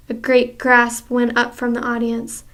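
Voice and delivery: plain reading voice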